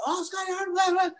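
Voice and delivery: high, excited imitation of a passing fan; starstruck fan. Passersby spotting Hartnell downtown, gushing in high mock voices. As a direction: mimic a fan gushing as he walks by. Fans greeting Hartnell in Philly.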